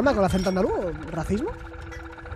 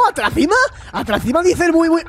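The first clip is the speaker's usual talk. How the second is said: high-pitched Andalusian robot voice; 8 semitones higher than usual. Acted. Robot companion hurrying to the volcano, high Andalusian accent. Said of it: "tiene acento andaluz el robot".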